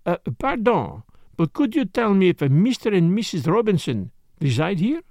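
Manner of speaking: put-on courteous French-accented detective; Poirot, polite inquiry.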